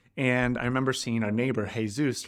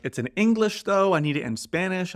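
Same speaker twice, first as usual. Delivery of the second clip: imitating worker; 6 semitones higher than usual.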